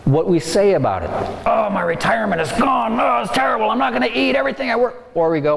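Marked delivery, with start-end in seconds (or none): 1.5-5.1 higher, hammed-up panicked lament, racing at the end; mock panicked wail. Market dropping, everybody mock-panicking over lost retirement, high panicky wail. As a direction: wail like the world is ending. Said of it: "Everybody's going".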